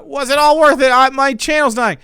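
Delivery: put-on Australian accent. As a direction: wail it in a hammed-up Australian accent. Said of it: "how do Australians say".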